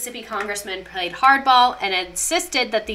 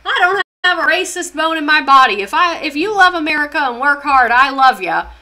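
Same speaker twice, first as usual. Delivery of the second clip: higher and louder, hammed-up folksy claim of no racism; mock folksy patriot; 5 semitones higher, 7 dB louder than usual. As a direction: play a loud, cheery flag-waver vouching for himself. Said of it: "this is how they talk".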